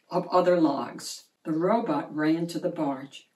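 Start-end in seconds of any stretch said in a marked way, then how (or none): none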